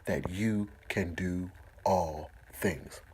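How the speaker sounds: very slow, drawn-out words; slow it right down; slow emphasis. Shown as a demonstration. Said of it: "understand this".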